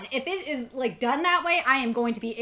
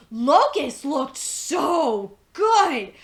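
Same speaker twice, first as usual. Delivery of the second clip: louder, slow and drawn out; awed gushing; 5 dB louder, much slower than usual. Real, not acted. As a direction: linger on it in awe. Gushing over a character.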